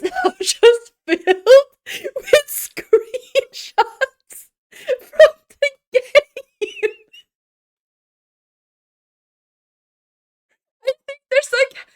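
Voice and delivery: high, loud laughing wail; helpless laughter. Real, not acted. Streamer confessing a folder full of screenshots, laughing helplessly. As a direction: squeal through laughter, nearly crying. Breaks up over screenshot mistake.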